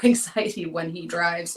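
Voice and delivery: ordinary delivery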